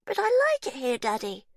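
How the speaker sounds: put-on sweet, eerie little girl. A narrator's reading. Faith telling her daddy she likes it here, high child voice. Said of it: "Faith said".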